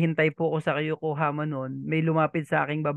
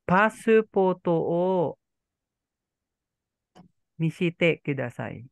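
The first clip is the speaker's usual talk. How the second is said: "Paso photo o misite kudasai" slowly, in chunks; slow teaching model; slower than usual. Shown as a demonstration. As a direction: draw it out for a learner, pausing before "misite". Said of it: "ito po yung maririnig nyo".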